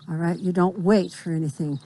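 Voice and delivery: ordinary delivery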